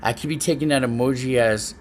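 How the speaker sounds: ordinary talk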